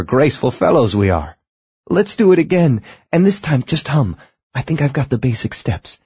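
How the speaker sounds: bright, high, lively lady's voice; a delighted young woman learning to dance; eager young woman. Acted. Young woman voiced while dancing.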